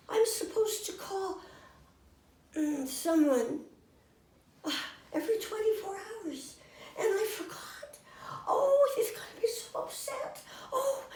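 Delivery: high, tearful fretting; panicky. Retold or imitated. Marilyn fretting to the ladies about a missed call, high and tearful. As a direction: play her near tears, rising worry.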